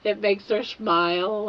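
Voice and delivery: ordinary talk, nothing marked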